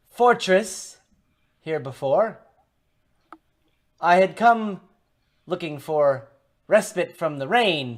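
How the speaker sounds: high, loud, projected character voice; stranger at the door. Acted. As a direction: voice a traveller calling through a door. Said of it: "and he says".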